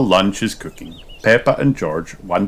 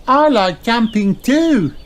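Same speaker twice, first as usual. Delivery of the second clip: put-on very high slow child voice; sing-song little kangaroo; Kylie; 5 dB louder, slower than usual. A narrator's reading. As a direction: Kylie happily joining in. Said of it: "she says".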